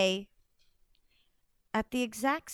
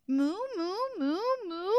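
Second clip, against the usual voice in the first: tearful moo; 6 semitones higher than usual. Acted. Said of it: "who could only say".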